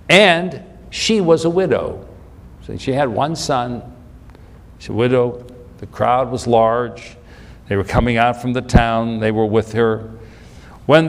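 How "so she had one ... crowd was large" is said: normal talking voice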